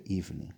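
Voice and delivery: "evening" with a true V; clear V sound. Shown as a demonstration. Teacher corrects for the letter V, "evening" with V. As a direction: say "evening" softly, with a V in the middle. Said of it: "V 발음을 해줘야 되죠".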